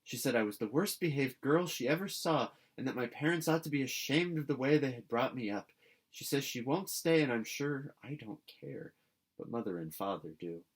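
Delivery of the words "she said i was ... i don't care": ordinary delivery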